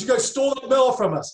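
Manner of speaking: hammed-up grumbling accusation; imitating Charles Barkley. Retelling Charles Barkley's complaint.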